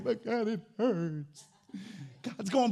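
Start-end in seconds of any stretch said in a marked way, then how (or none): 0.0-1.5 whiny complaint. Pretend. Whining reply to God.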